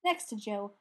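usual speaking voice

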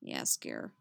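very low, soft, dark name-call; dark, hushed low voice. A narrator's reading. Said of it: "low and dark".

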